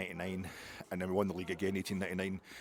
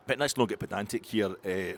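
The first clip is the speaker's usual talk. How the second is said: ordinary talk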